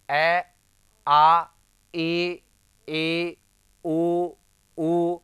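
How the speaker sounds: "ए, आ, ई, ई, उ, उ" called out singly, loud and held, with pauses; unobstructed vowel demonstration. Shown as a demonstration. Teacher showing students "ए, आ, ई, ई, उ, उ" as separate, freely flowing vowels. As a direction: sound out "ए, आ, ई, ई, उ, उ" as open, sustained vowels. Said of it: "देखिएगा".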